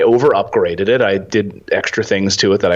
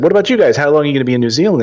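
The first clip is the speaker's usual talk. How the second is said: eager question; 7 semitones higher, much faster than usual. Retold or imitated. Retold question back to the couple.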